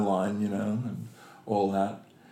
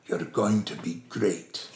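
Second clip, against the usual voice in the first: Sean Connery; 2 semitones lower than usual. Retold or imitated. Star to nervous actor after massage, imitated measured reassurance. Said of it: "looked down at me and went".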